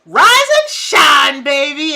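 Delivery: loud, slow, high crowing call; booming sing-song wake-up; rooster wake-up call. Acted. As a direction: belt it like a rooster at dawn.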